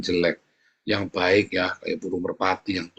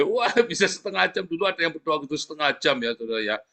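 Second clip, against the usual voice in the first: normal talking voice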